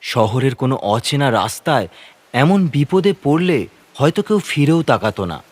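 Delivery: ordinary delivery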